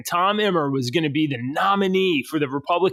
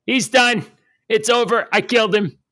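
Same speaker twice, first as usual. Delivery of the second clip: lightly imitated politician, pitched higher; Donald Trump; 6 semitones higher, 3 dB louder than usual. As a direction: announce it in short higher bursts, mimicking a boastful politician. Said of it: "He said".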